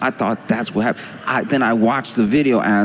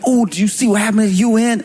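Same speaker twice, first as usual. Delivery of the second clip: excited friend; 12 semitones higher, 3 dB louder than usual. Retold or imitated. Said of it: "They were like".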